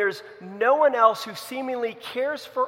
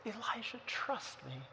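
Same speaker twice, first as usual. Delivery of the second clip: tender reassurance; 4 semitones higher, 13 dB quieter than usual. Retold or imitated. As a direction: quietly reassure someone who feels all alone. God tenderly reassures lonely Elijah.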